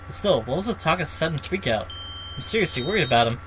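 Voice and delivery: ordinary delivery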